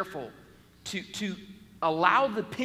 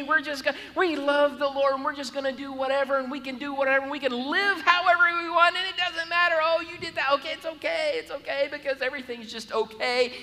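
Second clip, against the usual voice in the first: high, loud, carefree mimic of a lax believer; breezy anything-goes voice; 6 semitones higher, 5 dB louder than usual. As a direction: gush that everything is okay, hammed-up. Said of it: "if we're on the side of grace".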